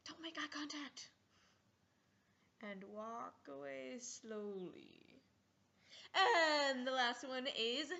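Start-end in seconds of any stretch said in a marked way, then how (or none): 0.1-5.2 unvoiced whisper, then slow; wary whisper. Onlookers after weird call, whispering and edging away. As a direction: whisper it and back off slowly.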